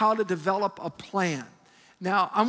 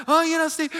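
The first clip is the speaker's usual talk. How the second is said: imitating bubbly friend; 7 semitones higher, 6 dB louder than usual.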